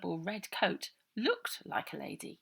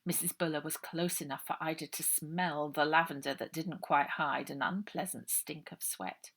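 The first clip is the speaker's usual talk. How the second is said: ordinary delivery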